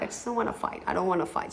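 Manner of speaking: backing down from a fight, hushed; quiet giving up. Retold or imitated. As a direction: trail off, too tired to argue. Retold giving up on arguing.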